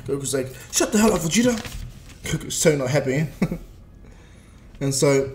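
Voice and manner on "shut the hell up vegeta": louder, higher irritated retort; annoyed snap. Retold or imitated. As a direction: shut him down, fed up. Goku annoyed at Vegeta.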